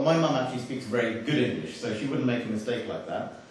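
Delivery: ordinary talk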